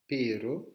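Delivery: PERO with a single tapped R, rising; R simple. Shown as a demonstration. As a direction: say pero lightly tapping the R once. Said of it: "un simple R". (R battu une fois)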